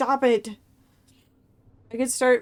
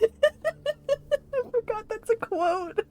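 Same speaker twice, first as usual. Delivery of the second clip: high, slow words squeezed out through laughter; helpless giggle at her own slip; laughing; much slower than usual. Real, not acted. Streamer laughing at forgetting a quote, high and slow.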